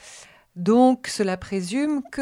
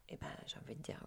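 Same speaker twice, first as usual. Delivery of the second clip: whispering; 4 semitones lower, 20 dB quieter, much faster than usual. Real, not acted. Whispered aside during a reading.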